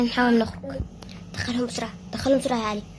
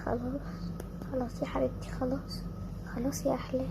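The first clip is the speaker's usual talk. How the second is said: soft, low hushing to calm her; quiet it down like rocking a child; softly calming a scared daughter; soothing hush; 4 semitones lower, 10 dB quieter than usual. Acted. Comforting a frightened girl.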